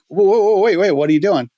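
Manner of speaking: loud, high alarmed call to stop him; startled, calling him to stop right away; alarmed shout. Retold or imitated. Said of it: "I'm like".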